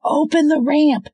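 very high, loud yelled order; girl yelling to open the ramp. Acted. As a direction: yell it across the square. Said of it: "the girl yelled".

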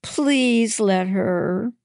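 high, slow, drawn-out plea; pleading. A narrator's reading. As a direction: beg Mom, stretching out the please. Daughter pleads with Mom for friend.

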